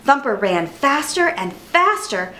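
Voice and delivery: plain reading voice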